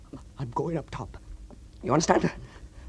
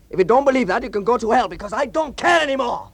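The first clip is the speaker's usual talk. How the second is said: higher and louder, defiant; angry shout; 9 semitones higher, 11 dB louder than usual. Acted. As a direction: shout your defiance at them. Defiant outburst at miners.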